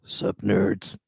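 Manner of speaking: put-on low, deep greeting. Greeting the show's hosts in a deep voice. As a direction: drop way down low for it. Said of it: "you went deep on that one".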